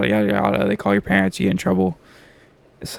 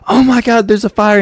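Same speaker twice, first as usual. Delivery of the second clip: higher, louder startled cry of "Oh my God"; panicked shriek; 9 semitones higher, 4 dB louder than usual. Retold or imitated. Classmate jumps up seeing sparks, startled cry. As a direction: yelp it like you just spotted flames. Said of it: "And she's like".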